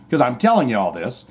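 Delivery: normal talking voice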